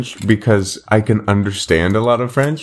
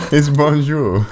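laughing; 8 semitones higher, slower than usual. Real, not acted. Correcting through laughter.

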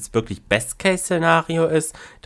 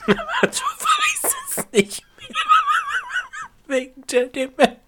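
laughing. Real, not acted. Joke delivered through laughter.

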